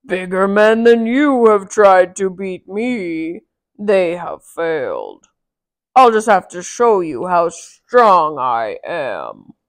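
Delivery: loud, slow booming giant voice; roaring giant. Acted. Giant boasting to Mickey, slow roar. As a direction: boast slowly and loudly like a giant. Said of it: "roared the giant".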